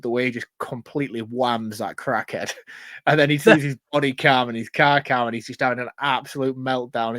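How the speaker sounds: ordinary talk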